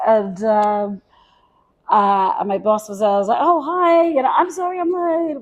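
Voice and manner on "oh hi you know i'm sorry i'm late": high, overly sweet sorry-I'm-late; mock polite apology. Greeting her boss after running in late, mock-sweet high apology. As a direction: play it brightly apologetic, pitched high.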